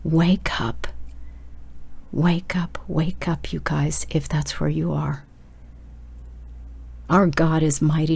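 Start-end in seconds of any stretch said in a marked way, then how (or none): 0.0-0.9 urgent whisper. Real, not acted. Preacher whispers a wake-up call to the listeners. 2.1-5.2 soft plea. Real, not acted. Preacher pleads with listeners.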